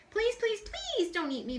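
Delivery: high, pleading little mouse; begging mouse. A narrator's reading. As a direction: beg for your life, tiny voice. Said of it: "begged the mouse".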